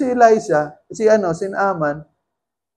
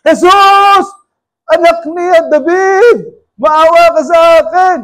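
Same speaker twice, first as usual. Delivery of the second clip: higher, louder desperate cry, slow; even louder shout; 9 semitones higher, 8 dB louder, slower than usual. Retold or imitated. Hushed by disciples, Bartimaeus shouts louder to Jesus. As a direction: yell harder as they try to hush you. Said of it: "lalo naman siya lumalakas ng sigaw".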